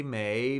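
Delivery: "may" rising steadily; second tone. Shown as a demonstration. Teacher cycling the tones with a syllable, "may" rising. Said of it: "second tone".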